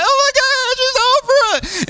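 very high, squealed fan sobbing, drawn out slowly at the end; mock ugly cry. Comedian seeing her idol walk on stage, mock ugly cry. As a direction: wail it like an overwhelmed fan, hammed up. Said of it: "she got the ugly cry".

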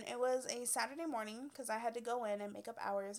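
ordinary talk, nothing marked

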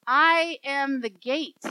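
slow, loud, weighty scripture reading; solemn declaration. A narrator's reading. Preacher reads Jesus' words to congregation, firm proclamation. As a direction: proclaim it with full conviction.

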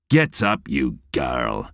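lightly voiced Glaswegian taunt, dropping low; a hint of Glaswegian, mocking the fallen man; rasping Glaswegian taunt. A narrator's reading. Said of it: "he taunted in his rasping Glaswegian accent".

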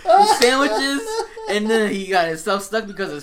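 high, loud, slow mock wail through laughter; laughing despair at a friend's bad luck; whining half-crying, half-laughing; exasperated laugh-cry.

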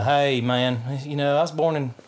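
put-on Conyers, Georgia country accent; mock small-town Georgia accent; imitated rural Georgia accent. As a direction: play him talking like himself, Georgia country. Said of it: "when he talks like himself, it's very".

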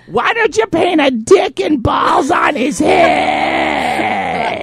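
slow, high mock-gleeful suggestion; imitating Kathy. Husband mimicking his wife egging on the littles. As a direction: drawl out the mischief. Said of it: "Kathy's like".

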